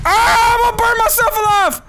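high, loud wail of alarm; yelling in high-pitched panic; panicked shout. Real, not acted. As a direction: scream out mid-disaster. Accidentally sets himself on fire.

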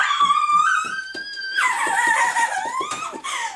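high thrilled shriek; excited scream. Real, not acted. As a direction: shriek with delight. Screaming on seeing the house.

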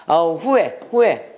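normal talking voice

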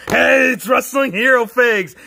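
drawn-out hype shout of the channel intro; slow, hammed-up intro yell. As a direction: belt it out like a cheesy show opener. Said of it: "that cringy intro by Wrestling Hero".

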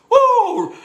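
high, laughing "woo"; high whoop. Real, not acted. Preacher laughing with the congregation, high whoop.

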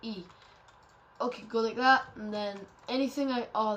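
ordinary delivery

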